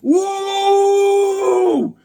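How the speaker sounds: very high, loud sung whoop; excited whoop. Real, not acted. Collector thrilled by a new comic, high sung whoop. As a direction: cheer like you just scored.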